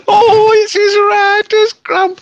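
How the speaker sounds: very high, loud, drawn-out sketch quote; Graham Chapman. Retold or imitated. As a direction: wail it out in a hammed-up comic voice. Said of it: "Graham Chapman who was in that sketch".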